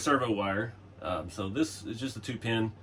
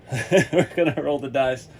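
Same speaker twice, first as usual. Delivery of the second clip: laughing; 3 semitones higher, 7 dB louder, slower than usual. Real, not acted. Laughing at risky plan.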